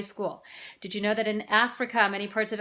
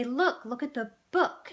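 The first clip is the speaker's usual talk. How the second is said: put-on lilting, higher parent-to-child voice; parent teaching a toddler; 4 semitones higher than usual. Parent showing a child a book, lilting encouragement. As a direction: point it out to a little one. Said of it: "No, we say".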